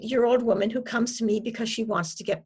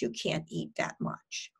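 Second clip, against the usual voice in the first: soft, low, matter-of-fact; hushed, detached restatement; calmly reframed thought; 5 semitones lower, 7 dB quieter than usual. Shown as a demonstration. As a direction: drop the alarm and name it quietly. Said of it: "I have them reframe those thoughts".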